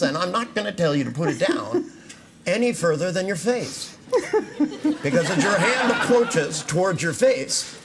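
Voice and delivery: can barely hold the laugh in; laughing through words. Real, not acted. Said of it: "the look on your face is priceless".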